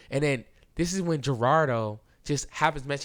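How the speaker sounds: usual speaking voice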